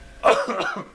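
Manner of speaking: sharp choking cough; coughing fit from swallowing wrong. Real, not acted. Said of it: "je m'étouffe".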